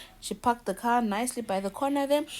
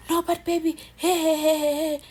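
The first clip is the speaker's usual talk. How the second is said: high pleading calls; mock high pleading hey; pleading man; 7 semitones higher than usual. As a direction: call after her, begging. Man begging the leaving wife.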